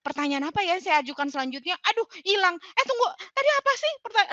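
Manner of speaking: fast, high, flustered searching for a question; rushed and high, scrambling for words. Shown as a demonstration. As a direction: act out a coach losing her next question in a panic. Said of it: "aduh sibuk sendiri".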